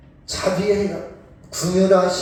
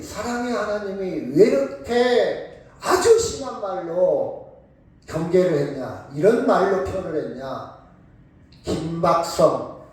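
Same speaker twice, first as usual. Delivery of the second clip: ordinary talk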